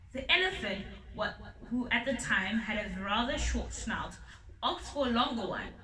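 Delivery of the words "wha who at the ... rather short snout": plain reading voice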